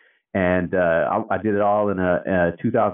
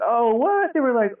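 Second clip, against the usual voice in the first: much higher, excited fan voice; starstruck recognition. Retold or imitated. Older site crew recognizing the famous page guy, high excited fan voice. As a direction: squeal it like you've spotted a celebrity.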